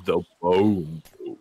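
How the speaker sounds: put-on deep voice for the name; really deep voice. Shown as a demonstration. Showing friends the running joke, really deep voice. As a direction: drop into a silly deep register. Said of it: "do it in a really deep voice".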